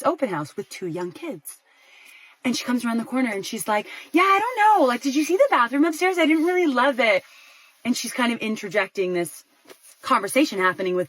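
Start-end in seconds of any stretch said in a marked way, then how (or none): 4.1-7.2 high, louder imitation of a chatty wife. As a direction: breeze in chatting, pitched up. Wife chatting about the house, interjecting.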